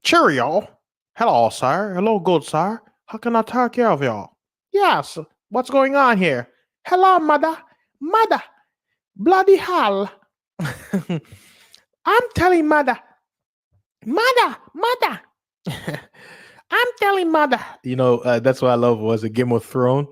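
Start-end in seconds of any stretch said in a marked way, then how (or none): 0.0-4.3 lightly high British butler; polite hammed-up British greeting; mock British accent. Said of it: "was it the British?". 4.7-17.6 very high whiny British brat; spoiled child tattling to mother; mock British accent. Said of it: "was it the British?".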